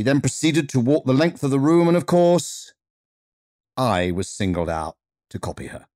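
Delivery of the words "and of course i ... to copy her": ordinary delivery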